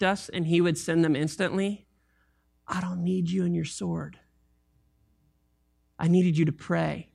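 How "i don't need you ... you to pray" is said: a soft unvoiced whisper, lower; whispered rebuke. Jesus whispering to Peter, gentle whispered rebuke.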